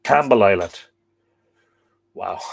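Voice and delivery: usual speaking voice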